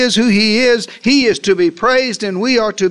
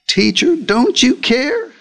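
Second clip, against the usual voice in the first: slow, higher, hurt reproach; accusing complaint; 7 semitones higher, slower than usual. Retold or imitated. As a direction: throw the question back at God. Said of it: "they accused God of being indifferent".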